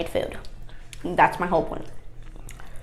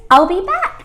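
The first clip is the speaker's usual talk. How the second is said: excited; 10 semitones higher, 7 dB louder than usual. Acted. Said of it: "I told you if I snap my fingers".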